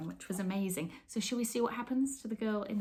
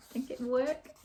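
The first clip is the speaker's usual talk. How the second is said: plain reading voice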